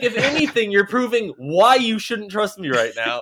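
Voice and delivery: high, loud retold rebuttal; mock exasperation. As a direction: hammer the point home in mock disbelief. Retold argument over trust.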